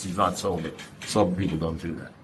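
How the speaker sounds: low, quiet, firm statement; quiet, certain "He's not sober"; flat verdict. Retold or imitated. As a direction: lay it down softly as plain fact. Sponsor's flat verdict retold.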